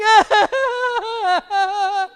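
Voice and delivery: high, loud put-on laugh like a wail; forced wailing ha-ha-ha; demonstrated laughing cry. Shown as a demonstration. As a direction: show laughter that could pass for crying.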